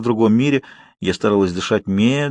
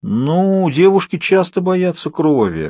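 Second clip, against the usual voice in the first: sagely sighing nurse; 7 semitones higher, slower than usual. Acted. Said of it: "глубокомысленно вздохнула санитарка".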